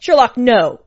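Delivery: loud, sharp maternal yell; urlato. A narrator's reading. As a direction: yell it out as an alarmed order to stop. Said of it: "Mummy yelled".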